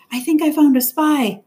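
put-on flustered girl announcing a spy; urgent report. A narrator's reading. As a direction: blurt it out to the first adult.

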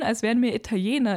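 usual speaking voice

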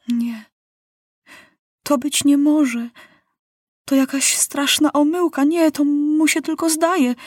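high, shaken denial; boy king's horrified disbelief; stunned disbelief. Acted. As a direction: refuse to believe what he sees.

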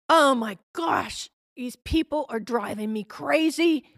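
exasperated husband. Retold or imitated. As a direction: vent like a fed-up husband after work. Husband complains about work.

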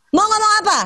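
high-pitched mock scolding mother; confronting catcallers. Mother walking up to a young catcaller, mock confrontation. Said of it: "pernah sih gue samperin".

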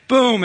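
boom sound. Retold or imitated. Drywall driven into place.

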